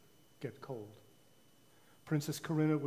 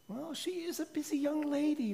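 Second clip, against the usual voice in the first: lightly voiced higher queen; a hint of a gentle queen; queen's voice; 12 semitones higher than usual. A narrator's reading. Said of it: "the queen said".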